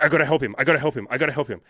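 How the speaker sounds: fast, urgent retold panic; anxious, restless. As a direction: rattle it off as if desperate to help. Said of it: "anxious, you know, un-, unresting energy".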